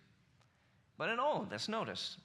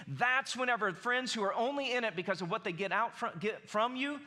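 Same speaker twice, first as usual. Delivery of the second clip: higher, louder pulpit push; preaching harder; 6 semitones higher, 3 dB louder than usual. Acted. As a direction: drive the point home to the congregation. Sermon climax, preaching harder.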